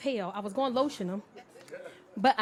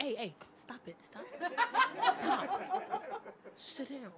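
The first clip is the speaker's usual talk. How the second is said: stern mother; 4 semitones higher, much slower than usual. Retold or imitated. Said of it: "I turn into a mother".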